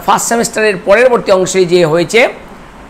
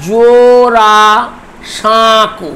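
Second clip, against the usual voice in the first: জোড়া সাঁকু drawn out as জো-ড়া-সাঁ-কু; syllable by syllable; much slower than usual. Shown as a demonstration. Teacher dividing the word for students, syllable by syllable. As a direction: stretch each syllable of জোড়া সাঁকু, pausing midway. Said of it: "চারটি দল আছে".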